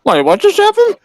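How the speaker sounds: high, startled what-just-happened; surprised. Real, not acted. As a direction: blurt it out in shock. Shocked by the sudden punch.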